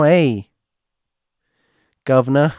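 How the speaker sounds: jokey attempt at an English accent, loud; bad English accent. Teasing English listeners, in a bad English accent. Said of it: "I'm not good with that accent".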